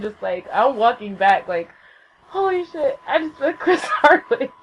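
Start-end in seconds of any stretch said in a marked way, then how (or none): 2.3-4.5 high, laughing disbelief; acted-out fangirl amazement; starstruck. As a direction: squeal it, breaking into a laugh. Retells dazed joy after meeting idol.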